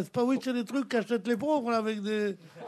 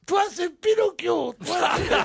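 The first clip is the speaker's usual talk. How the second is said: put-on little kid; 7 semitones higher than usual. Little boy accusing a big-nosed man, high imitated kid shout. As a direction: imitate a small boy calling someone out. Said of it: "il y a un petit mec qui est venu".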